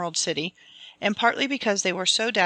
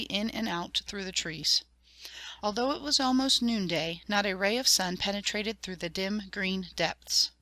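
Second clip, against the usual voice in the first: soft, quieter storytelling; hushed story narration; 4 dB quieter than usual. A narrator's reading. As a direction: draw the listener into the dark woods.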